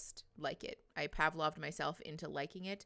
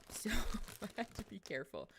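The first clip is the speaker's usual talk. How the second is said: ordinary delivery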